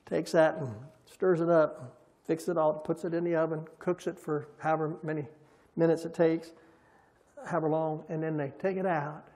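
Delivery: usual speaking voice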